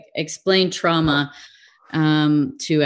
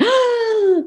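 mock shocked gasp. Parent hearing of childhood trauma, shocked gasp.

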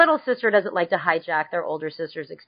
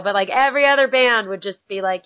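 ordinary talk, nothing marked

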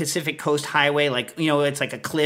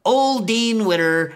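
higher, slow sing-song teaching tone; grandma drilling the parrot with a new phrase; grandma coaching the parrot; 6 semitones higher, 4 dB louder, slower than usual. Retold or imitated. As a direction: coax it slowly like training a bird. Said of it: "she would say".

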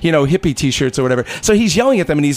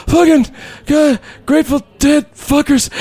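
put-on drunk Tim grumbling; 11 semitones higher than usual. Tim waking in the van hatch, high drunken grumble. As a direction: mumble curses like a passed-out drunk. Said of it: "He rolled over and he goes".

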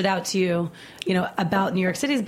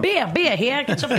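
higher and louder, laughing hawker cry; mock beer vendor call; 5 semitones higher, 3 dB louder than usual. Asked to try it, she playfully calls out like a vendor. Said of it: "Come on".

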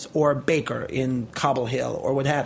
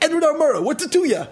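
high, loud Brooklyn comeback; defiant retort; 11 semitones higher, 5 dB louder than usual. Retold or imitated. Cyclist answering nosy drivers, defiant retort. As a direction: throw it back hard, mock tough. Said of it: "And I'm like".

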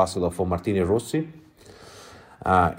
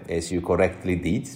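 usual speaking voice

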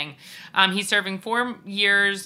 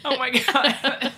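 laughing; 8 semitones higher, much slower than usual. Real, not acted. Reacts to a funny answer.

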